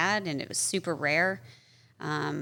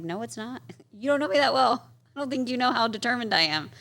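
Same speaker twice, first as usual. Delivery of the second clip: higher and louder with a laugh; defiant retort; 7 semitones higher, 4 dB louder than usual. Retold or imitated. To the pessimistic doctor, laughing defiant comeback. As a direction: laugh him off, fiercely sure.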